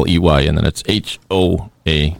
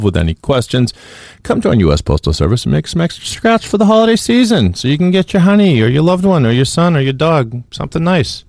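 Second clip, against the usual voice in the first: upbeat sponsor plug, rising higher; ad read; 4 semitones higher than usual. Acted. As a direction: sell it like a radio ad. Host reads sponsor ad.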